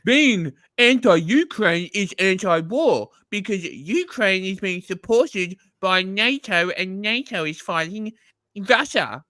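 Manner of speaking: slow mocking impression of a dim pundit; mocking Tim. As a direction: play him as a slow, smug idiot.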